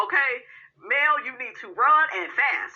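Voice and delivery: normal talking voice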